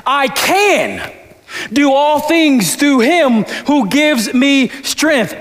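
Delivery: "I can" lifted high, pause, then the rest slow; stress on CAN. Shown as a demonstration. Pastor showing the church a stress on "can". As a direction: lean into "can" before pausing. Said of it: "how you can emphasize every word".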